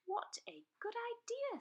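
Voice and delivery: high eager dragon pupil; Zog's voice. A narrator's reading. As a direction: pipe it up gladly. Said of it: "said Zog".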